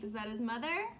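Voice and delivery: plain reading voice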